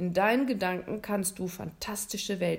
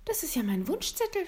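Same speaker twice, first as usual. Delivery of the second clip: put-on higher, surprised young boy; lightly voiced boy; 9 semitones higher than usual. William recognizing his own wish list, higher and surprised. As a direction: pitch it up like a startled child.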